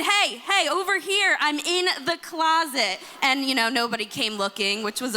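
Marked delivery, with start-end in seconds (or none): 0.0-3.0 put-on raised, higher call of a hiding kid; little girl's mild mock yell from hiding; mock childhood scream. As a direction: call out from the closet, half-yelling. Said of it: "Even when I screamed".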